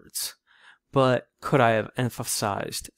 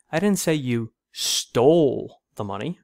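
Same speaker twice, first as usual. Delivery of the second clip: deliberate, heavy emphasis on "stole"; stress on STOLE; 4 dB louder than usual. Shown as a demonstration. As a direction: hit the word "stole" hard and stretch it. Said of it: "with emphasis on each word".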